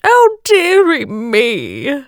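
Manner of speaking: high, drawn-out royal wail; storybook king's pretend sob; mock sobbing. A narrator's reading. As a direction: lament it slowly, hammed-up and high. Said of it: "The King sobbed".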